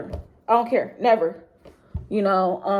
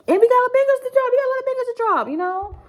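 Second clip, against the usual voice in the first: much higher, fast excited hype; faster than usual. Real, not acted. Promising his viewers new bangers, suddenly excited. Hyping upcoming music for viewers.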